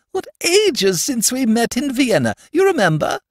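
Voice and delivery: a grande dame delighted to see the count; high gushing matron; gushing society lady. A narrator's reading.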